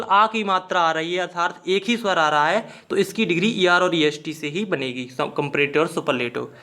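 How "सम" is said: ordinary talk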